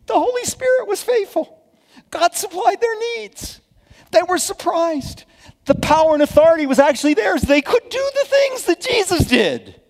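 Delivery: exultant sermon climax; rising preacher fervor. Real, not acted. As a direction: preach it to the rafters.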